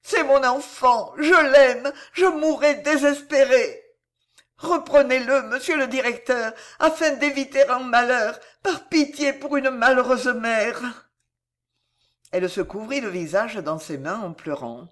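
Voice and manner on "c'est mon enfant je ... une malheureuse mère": desperate mother sobbing to the headmaster; sobbing plea. A narrator's reading. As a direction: sob out her love for her son. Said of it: "éclata en sanglots".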